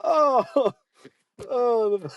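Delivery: very high, squeezed, laughed-out sigh; helpless laughter. Real, not acted. Recovering from laughing fit.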